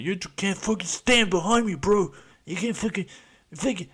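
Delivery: mock bro. Mocking a guy shouting at someone behind him, high bro voice. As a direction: puff up like a drunk bro. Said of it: "some fucking guy's like".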